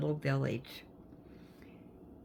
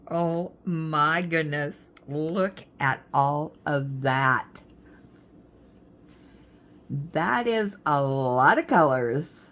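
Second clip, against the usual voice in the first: amazed; 2 semitones lower, 7 dB louder, slower than usual. Real, not acted. Amazed at the big kit.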